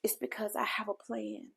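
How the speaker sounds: soft unvoiced whisper of reassurance; whispered answer. Speaker voicing God's reply to the grieving, whispered. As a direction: hush it like a secret promise.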